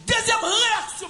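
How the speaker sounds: high, loud and slow; preacher's announcement. Acted. Preacher announcing the next point to the church, loud.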